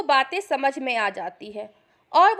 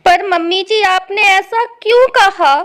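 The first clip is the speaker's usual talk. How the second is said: loud, wounded protest; acted tearful challenge; 8 dB louder than usual. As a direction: demand a reason, hurt and upset. पर तुम रोए जा रही हो.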